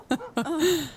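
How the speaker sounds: soft, high chuckle; laughing. A narrator's reading. Louise and Elle watch the dog bolt, laughing. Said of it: "Louise and Elle laughed".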